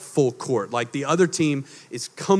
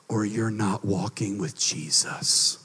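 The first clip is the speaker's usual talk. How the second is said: breathy whispered aside, slow and soft; 8 semitones lower, 8 dB quieter, slower than usual. Real, not acted. Preacher whispering the warning to the congregation. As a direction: drop to a hushed whisper for emphasis. Sermon point, dropped to whisper.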